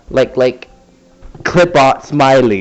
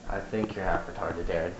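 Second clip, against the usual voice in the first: much softer, lower throwaway jab; muttered aside; 6 semitones lower, 18 dB quieter than usual. Real, not acted. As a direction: say it under your breath to Darren.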